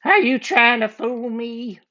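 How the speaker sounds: high, suspicious cartoon bad guy; outlaw voice. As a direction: squint and accuse.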